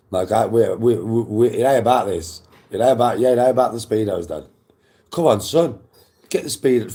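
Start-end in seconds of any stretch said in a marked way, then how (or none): 5.1-6.9 higher, louder mock dad coaxing; imitating dad. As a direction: play dad egging his son on, raised up. Dad voiced in retold story.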